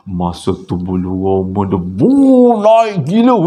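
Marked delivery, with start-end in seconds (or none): 1.8-3.4 high, loud shudder of fright; loud frightened exclaim; spooked. Retold or imitated. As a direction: shiver the words out as the hair stands up. Goosebumps rising in fear.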